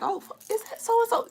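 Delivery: high, soft gasp of recognition; surprised recognition. Retold or imitated. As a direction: spot someone unexpectedly. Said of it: "It's like".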